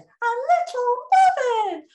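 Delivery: high squeaky little puppet. Acted. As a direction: pipe it up like a tiny puppet. Puppet voice introduced by name.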